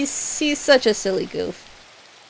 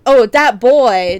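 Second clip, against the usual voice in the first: excited recall; 9 dB louder, slower than usual. Real, not acted. Recalls a meme, loud.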